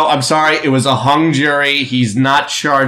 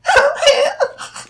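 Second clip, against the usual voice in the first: high falsetto whoop. Acted. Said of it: "now you talk like Michael".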